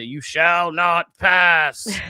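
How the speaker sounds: slow, loud, booming wizard decree; Gandalf. Retold or imitated. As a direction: plant the staff and proclaim it slowly. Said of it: "Matt is like Gandalf".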